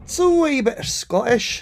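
lightly put-on Scottish "wee bit"; Scottish accent. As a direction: cohosts joking, playful Scottish accent.

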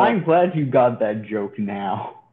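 loud, drawn-out sarcastic congratulation; pointedly slow, loud jab. Real, not acted. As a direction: rub it in slowly and loudly. Needling friend who missed joke.

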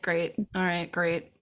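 soft, very slow, flat mock enthusiasm; deadpan sarcasm. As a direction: drag it out with bored sarcasm. Sarcastic reaction to the name.

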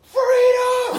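very high, loud hammed-up shout; battle cry. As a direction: bellow it like a mock war cry. Mock Braveheart battle cry.

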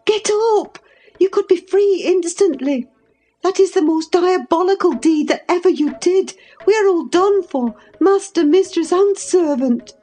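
high, frantic scolding; passionate reproach. A narrator's reading. Housekeeper berates the lover who endangers them all, passionate. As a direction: berate him urgently, pitched far up throughout. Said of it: "I said passionately".

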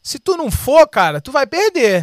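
voice of God. Retold or imitated. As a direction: boom it out like God warning him. God speaking in retold story.

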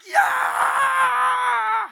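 long, high, loud yeah; yelling back. Retold or imitated. Pastor yells back at the drunk fan. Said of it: "and I was just kinda like".

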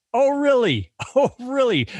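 high, mocking "Oh, really?"; mocking a senator's hypocrisy, high and sarcastic. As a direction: throw it back at him with scorn. Mocking Ted Cruz's claim.

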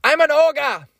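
high-pitched joke impression; hammed-up, high mock old-movie actor; deliberately silly high-voiced impersonation; Jimmy Stewart. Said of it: "My impression of, uh, of, of Jimmy Stewart".

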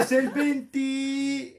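very slow, high and loud; drawn-out guess. Real, not acted. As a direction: stretch it out loudly while searching for the number. Stalling while guessing a friend's birthday.

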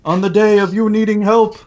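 high-pitched goblin voice; squeaky, insistent little crime boss; excitable goblin boss. Acted. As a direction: pitch it way up, indignant.

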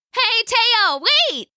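high, loud call after him; calling rabbit. A narrator's reading. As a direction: shout to stop him. Rabbit calls out.

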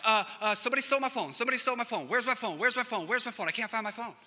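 high, frantic, breathless repeats; panicked kid. Retold or imitated. Son calling in panic over a stolen phone.

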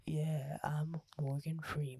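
a lightly voiced, low, whispery Morgan Freeman; a hint of a deep Morgan Freeman drawl, barely there; terrible Morgan Freeman. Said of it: "That was a terrible Morgan Freeman accent".

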